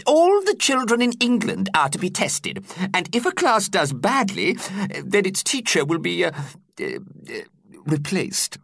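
put-on high, fretful old man voice; a kindly teacher stumbling over bad news; worried old teacher. A narrator's reading. Teacher hesitantly warning his class in a high fretting voice.

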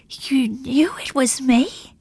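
slow, breathless whispered question. Acted. Bella stunned that Dorothy knew, whispering slowly. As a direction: barely breathe the words.

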